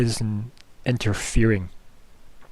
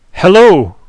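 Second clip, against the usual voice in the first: a much higher, much louder yell across the room; shouted hello; 8 semitones higher, 14 dB louder than usual. Acted. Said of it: "and then just shouts".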